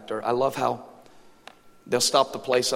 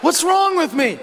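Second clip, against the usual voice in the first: high, loud comic wail; hammed-up cry of self-reproach; exasperated shout; 8 dB louder, slower than usual. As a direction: shout it in mock despair. Cries out at own failing.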